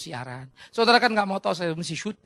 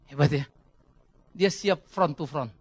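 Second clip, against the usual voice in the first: usual speaking voice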